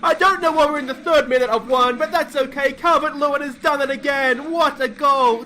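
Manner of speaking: screaming high and loud, commentator-style; ecstatic goal scream. Real, not acted. Gamer screaming as his striker scores late, ecstatic. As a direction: roar it like the ball just hit the net. Last-minute equaliser in football game.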